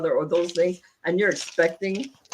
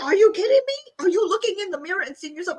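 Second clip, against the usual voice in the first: much higher, fast retold tirade; furious outburst; 11 semitones higher, faster than usual. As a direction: let him have it, voice up high. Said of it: "And I went off".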